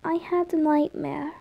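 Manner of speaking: put-on soft, small child voice; hushed, upset little boy; shaken kid. A narrator's reading. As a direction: confess it timidly, quietly. Child confessing a nightmare.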